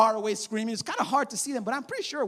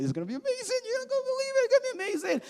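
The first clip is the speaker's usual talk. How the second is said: higher, thrilled and eager; excited promise; 11 semitones higher, 4 dB quieter, much faster than usual. Retold or imitated. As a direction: build up their excitement. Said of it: "And Jesus like".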